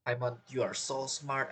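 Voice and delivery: usual speaking voice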